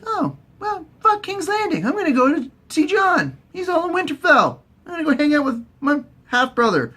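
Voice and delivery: very high mock girl's voice; Arya. Arya deciding to visit Jon, high breezy voice. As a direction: pitch it up, breezily changing plans. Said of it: "She's all like".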